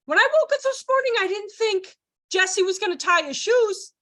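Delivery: high, astonished, over-the-top praise; authentic amazement. Shown as a demonstration. Teacher amazed a child tied his shoes, excited disbelief. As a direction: marvel at it in a soaring high voice. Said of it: "See how that's authentic?".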